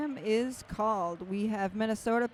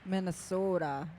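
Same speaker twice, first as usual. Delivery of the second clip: put-on upper Midwest accent; 3 semitones lower than usual. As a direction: do Minnesota like a local from the upper Midwest. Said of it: "I love that upper Midwest accent".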